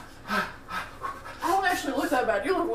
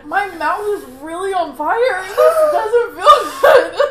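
crying laugh; 3 semitones higher, 6 dB louder than usual. Real, not acted. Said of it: "y'all are both crying".

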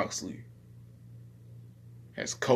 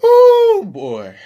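high excited shout, much higher and louder; 17 dB louder than usual. Real, not acted. Excited about PS5.

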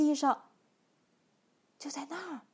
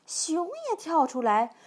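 ordinary delivery

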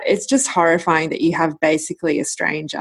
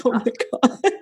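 amused disbelief; 5 semitones higher than usual. Retold or imitated. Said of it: "And I was like".

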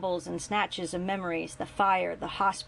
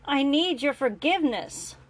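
higher, louder plea; voice raising a bit; 7 semitones higher, 5 dB louder than usual. A narrator's reading. Grant to his son, raised pleading. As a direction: push the plea up a notch. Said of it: "his voice raising just a bit".

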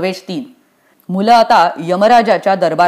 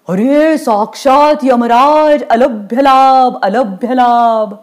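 slow, high, drawn-out marvelling; stretched-out starstruck greeting; exaggerated awe; 4 semitones higher, slower than usual. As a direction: hail him with theatrical delight. Boy marvels at seeing Yamraj.

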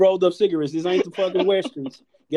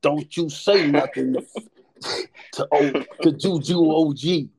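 stern lady; 3 semitones lower, slower than usual. Retold or imitated. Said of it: "She was like".

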